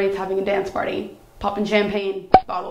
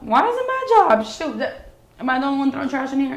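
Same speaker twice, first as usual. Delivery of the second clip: indignant retort; 5 semitones higher, 3 dB louder than usual. Retold or imitated. Arguing with roommate over trash.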